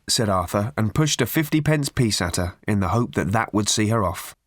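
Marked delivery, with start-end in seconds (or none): none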